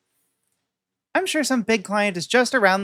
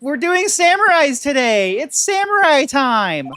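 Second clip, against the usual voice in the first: put-on high, loud excited girl voice; 8 semitones higher, 4 dB louder than usual. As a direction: gush it out high and eager.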